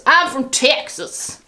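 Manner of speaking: slow, drawn-out mock Texas accent. Vlogger mocking her own attempt, hammed-up Texas accent. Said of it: "That's not a Texas accent".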